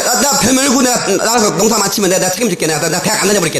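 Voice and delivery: ordinary delivery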